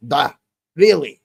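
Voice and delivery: lively Russian-accented challenge. Shown as a demonstration. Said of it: "do you want me to talk Russian".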